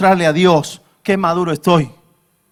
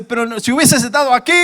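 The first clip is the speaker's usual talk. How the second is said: mildly indignant, higher-pitched reproach; angry Martha; 5 semitones higher, faster than usual. Acted. Preacher acts Martha blaming Jesus for absence, annoyed. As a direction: reproach him with rising frustration. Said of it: "Y enfadada y le dice".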